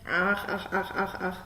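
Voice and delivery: low, soft croaking frog. A narrator's reading. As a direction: croak each ach like a frog by the pond. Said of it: "Die robt immer". (leises Froschquaken)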